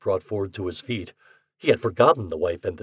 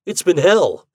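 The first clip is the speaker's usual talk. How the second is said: bitter; 7 semitones higher, 8 dB louder than usual. A narrator's reading. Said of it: "he exclaimed bitterly".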